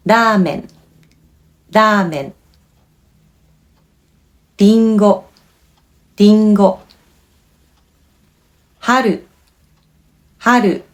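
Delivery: each word twice with a tapped Japanese r; repeat-after-me words. Shown as a demonstration. Teacher leading a repeat-after-me drill. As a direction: say each word clearly for learners to copy. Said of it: "you can repeat after me".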